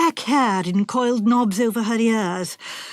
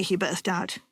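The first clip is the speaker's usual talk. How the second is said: ordinary delivery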